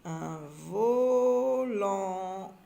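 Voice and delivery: volant held very long on vo and lant; drawn-out syllables. Shown as a demonstration. Teacher sounding out volant while writing, syllables stretched. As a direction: draw "volant" right out, very slowly. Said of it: "J'entends en dans volant".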